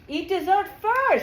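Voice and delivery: loud, high and drawn out; delighted echo. Real, not acted. Coach cheering a client's dessert hook, loud and delighted. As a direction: relish each word with big energy.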